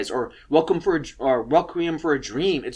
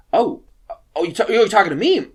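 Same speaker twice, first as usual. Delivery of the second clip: higher, stammered, mock-bashful question; imitated bashful surprise; 5 semitones higher, 4 dB louder than usual. Receptionist to the teacher who offered coffee, bashfully surprised. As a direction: act out flustered disbelief at being spoken to. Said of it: "she gets, like, all bashful".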